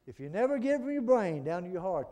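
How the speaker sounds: loud, emphatic pulpit delivery; forceful preaching. Real, not acted. Preacher urges listeners from brain to heart, forceful preaching. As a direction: drive it home to the congregation.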